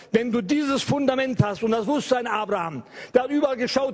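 usual speaking voice

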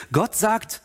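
usual speaking voice

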